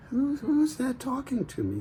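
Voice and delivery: high, puzzled farmer's voice; startled farmer. Acted. Farmer hearing a voice, high and startled. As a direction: ask it high and bewildered.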